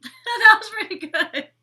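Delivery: high, loud, laughing self-praise; amused at your own impression. Real, not acted. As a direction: crack up while speaking. Cracks up at own accent attempt.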